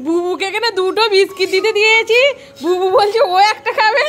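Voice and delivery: loud high call to the dog. Real, not acted. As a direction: call the dog over, half laughing. Calling dog, laughing.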